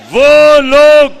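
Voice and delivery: loud slow declamation to the crowd; loud rally shout. Real, not acted. Leader to rally crowd, slow loud shout. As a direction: hammer it out to the rally.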